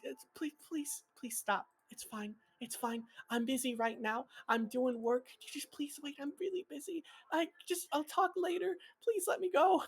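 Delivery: high, soft begging to hang up; high pleading. Acted. A busy woman begging a caller to let her go, high and soft. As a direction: plead to end the call, voice climbing.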